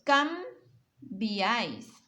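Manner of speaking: Cambiáis drawn out very slowly; slow example word. Shown as a demonstration. Teacher reading cambiáis slowly to the class. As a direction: stretch cambiáis so the triphthong is heard. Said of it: "aquí también en dos".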